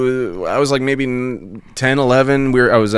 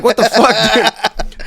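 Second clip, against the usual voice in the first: shocked; 12 semitones higher, slower than usual. Retold or imitated. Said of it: "And I'm like".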